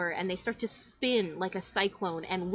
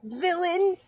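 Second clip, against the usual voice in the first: put-on much higher, searching kid voice; confused child hero; 10 semitones higher, 4 dB louder than usual. Child hunting the vanished shadow, high searching call. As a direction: call out like a lost little hero. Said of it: "and is like".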